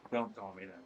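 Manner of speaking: ordinary talk, nothing marked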